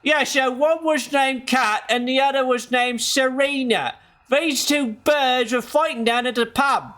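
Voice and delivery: high-pitched, over-the-top British pub bloke; badly faked British guy telling a pub story; bad British accent. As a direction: put on a hammed-up, terrible British accent. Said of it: "British guy telling you about the two birds".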